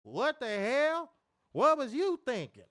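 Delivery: high, drawling mock-country outburst; hammed-up caricature of a comedian; Steve Harvey. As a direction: stretch it out in exaggerated disbelief. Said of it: "Steve Harvey talk like that".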